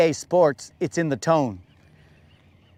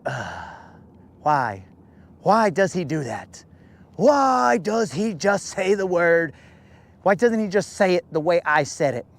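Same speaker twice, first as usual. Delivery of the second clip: annoyed moderator. Retold or imitated. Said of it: "she really hates it".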